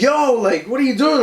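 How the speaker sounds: retold yelling. Grandson challenging the man on the ladder, retold yell. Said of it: "I'm yelling out too now".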